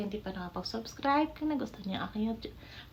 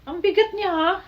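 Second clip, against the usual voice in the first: surprised; 9 semitones higher, 12 dB louder than usual. Real, not acted. Surprised at heavy device.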